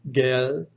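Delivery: gel drawn out with a hard g, rising; isolated syllable. Shown as a demonstration. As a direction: say gel slowly as a separate middle syllable. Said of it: "there are three syllables".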